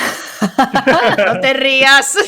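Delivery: slow and loud through real laughter; pleading while cracking up; laughing. Real, not acted. Laughing while asking not to laugh.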